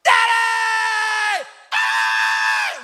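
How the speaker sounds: high, full-voiced shriek of kids greeting dad; imitating overjoyed kids screaming; excited kids. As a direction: shout it like children mobbing their father. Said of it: "They start running up".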